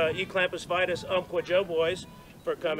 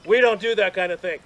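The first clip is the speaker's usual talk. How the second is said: louder, emphatic telling-off; mock scolding; 2 semitones higher, 9 dB louder than usual. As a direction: imitate a brother laying down the rules. Retold finger-wagging scolding.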